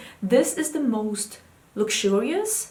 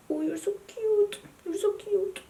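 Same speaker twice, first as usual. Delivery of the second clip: cooing pet-talk; 11 semitones higher, 4 dB quieter than usual. Real, not acted. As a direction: gush sweetly over your cat, high and soft. Cooing at her cat.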